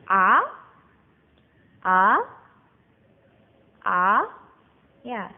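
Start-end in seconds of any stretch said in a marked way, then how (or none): 0.0-4.3 A swept sharply upward like someone startled; surprised-sounding A, pitch shooting up; rising tone. Shown as a demonstration. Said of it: "bacanya kayak orang kaget".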